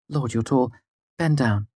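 high bright teasing woman's voice; playful teasing woman. Acted. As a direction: tease her fondly.

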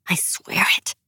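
whispered, deadly oath; quiet vengeful whisper; whispered vow. A narrator's reading. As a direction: swear it under your breath. Nina's vow of revenge.

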